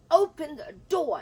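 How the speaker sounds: loud, high shout through a locked door; shouted demand. Retold or imitated. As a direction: bang on the door and yell it.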